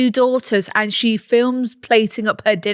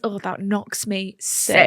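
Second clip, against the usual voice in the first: slow, soft, low Scouse; subdued Scouse imitation; Scouse catchphrase; 4 semitones lower, 10 dB quieter, slower than usual. Shown as a demonstration. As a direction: mutter it in a Liverpool accent. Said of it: "Sounds quite nice in our voice".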